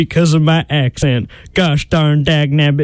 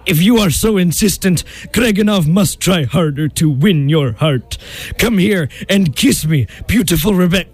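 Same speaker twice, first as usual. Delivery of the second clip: wooing mock Russian accent. Disguised suitor trying to win the bride, Russian accent. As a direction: court her in a put-on Russian accent. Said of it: "practiced his Russian accent".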